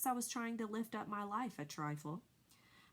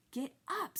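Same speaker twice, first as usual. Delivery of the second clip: screaming; 8 semitones higher than usual. A narrator's reading. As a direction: scream at him to move. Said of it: "screamed Wilbur".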